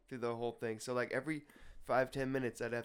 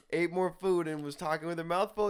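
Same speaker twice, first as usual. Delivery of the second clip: usual speaking voice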